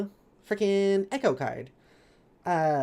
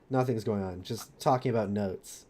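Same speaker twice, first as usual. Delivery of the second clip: low, slowing character voice; low-pitched, unhurried rogue voice; friend impression; 7 semitones lower than usual. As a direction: drop the pitch and brush it off casually. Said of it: "I like doing my friends' voices".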